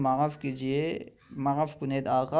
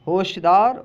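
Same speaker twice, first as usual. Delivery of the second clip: sharp mock warning; 3 semitones higher, 7 dB louder than usual. Shown as a demonstration. Warning word modelled.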